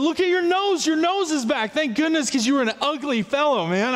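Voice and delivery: high, amazed and thrilled; excited cry. Retold or imitated. Leper marvelling at his friend's new nose. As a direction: blurt it out in giddy surprise.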